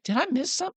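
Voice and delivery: put-on very high, curious kid voice; put-on high-pitched questioning voice; Nellie. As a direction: pipe up high, puzzled. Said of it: "she goes".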